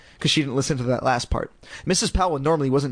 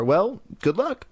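dry; 5 semitones higher, slower than usual. Acted. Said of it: "she said dryly".

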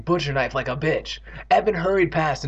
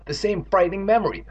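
ordinary delivery

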